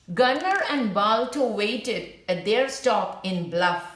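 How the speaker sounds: clear, gentle storybook reading; reading to children. A narrator's reading. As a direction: read the story aloud to young listeners. Teacher reads story to class.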